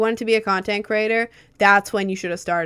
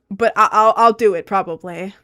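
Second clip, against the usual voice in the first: usual speaking voice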